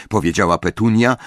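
ordinary delivery, nothing marked

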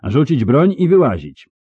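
high, loud command to surrender; loud battlefield challenge; shouted order. Acted. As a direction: order them out at full voice. Knights shouting orders at fugitives.

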